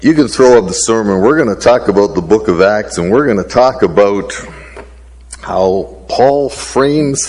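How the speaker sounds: energetic fast preaching. Acted. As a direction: preach it out to the congregation. Opens the sermon at full force.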